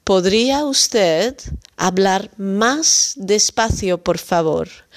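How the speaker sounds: slow clear model. Shown as a demonstration. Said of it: "dan kunt u wat langzamer spreken".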